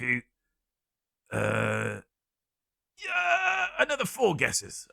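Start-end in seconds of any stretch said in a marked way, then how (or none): 3.0-4.9 shout. Acted. Host yelling out the guesses.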